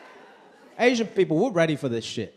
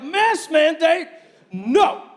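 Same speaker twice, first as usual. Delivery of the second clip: high, slow, loud scoffing no; mock defiant refusal; 7 semitones higher, 4 dB louder, much slower than usual. Comic imitating anti-mask Americans, mock defiance. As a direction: scoff at the rule like a rebel. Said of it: "They're like".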